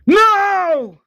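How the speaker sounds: long, high, anguished scream of no. Retold or imitated. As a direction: wail it out, stretched high. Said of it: "Avesta screams".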